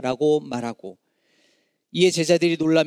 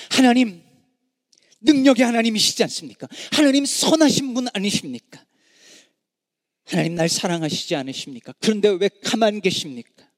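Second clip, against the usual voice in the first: pleading cry; 3 semitones higher than usual. Acted. Preacher voicing a sufferer questioning God, raised pleading cry. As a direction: cry out in hurt disbelief.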